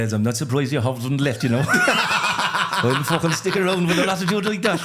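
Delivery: hammed-up Irish accent, laughing through it; mock Irish accent. Comedian trying the suggested Irish voice on his passengers, mock Irish accent. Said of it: "you just go full Irish accent".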